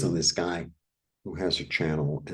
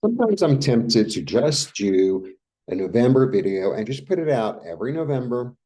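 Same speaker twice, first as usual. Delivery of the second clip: ordinary talk, nothing marked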